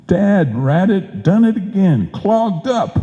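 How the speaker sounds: high-pitched sputtering frustration at the clogged sprayer; exasperated outburst. Acted. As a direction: blow up like the gadget beat you again. Sprayer clogs up again.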